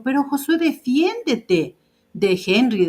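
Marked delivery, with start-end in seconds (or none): 0.0-1.8 higher-pitched, slowed urging on defiéndete; lightly voiced Anita pushing him to stand up; urging him to defend himself. Anita telling Josué to stand up for himself, higher urging voice. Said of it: "Anita alguna vez le dijo".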